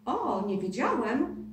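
slow, mock-innocent surprise; hammed-up fake astonishment; feigned surprise. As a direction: pretend you never knew. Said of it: "Adaś udawał zdzawionego".

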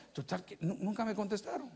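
usual speaking voice

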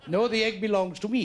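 put-on refusal in a caricatured Indian accent; mock Indian accent. Neighbour refusing to return an egg, mock Indian accent. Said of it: "That is Indian law".

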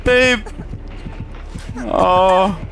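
loud high frantic shouting; being chased. Real, not acted. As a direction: yell at them to run. Teammate chased, urging her to run.

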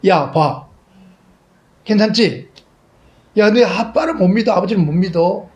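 high, loud teasing father reassuring; cheerful father. Retold or imitated. Father laughing as he catches his boy, high and loud. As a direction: tease your son after catching him.

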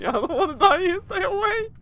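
hammed-up fright, half laughing; mock terror. Laughing as the killer comes for him, mock terror.

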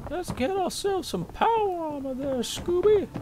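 silly high cartoon sidekick voice; goofy cartoon voice. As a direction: ham it up like a Saturday cartoon. Cartoon voice while gaming.

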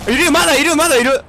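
high, pressing warning; urgent callout. Real, not acted. Teammate warns enemies are still there, urgent callout.

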